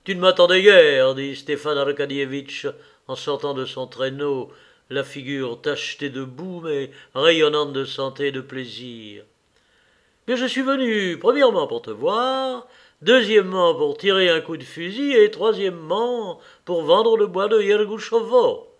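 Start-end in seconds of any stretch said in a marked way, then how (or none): 0.1-1.1 raised, much louder hail; hearty greeting. A narrator's reading. Friend makes a surprise arrival. 10.3-18.7 high, loud, emphatic enumeration; lively, raised character voice; jovial visitor. A narrator's reading. Jovial visitor lists his reasons.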